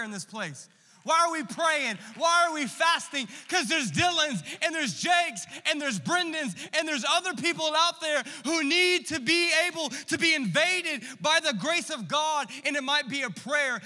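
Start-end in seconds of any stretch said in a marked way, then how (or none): 1.0-3.3 preaching out. Acted. Preacher raising rhetorical questions. 3.5-13.8 preaching. Acted. Preaching at full force.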